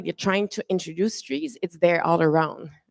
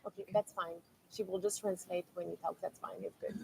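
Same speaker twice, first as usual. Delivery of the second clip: soft, hushed reassurance; quiet reassurance; 16 dB quieter than usual. Real, not acted. Moderator reassures quietly, aside.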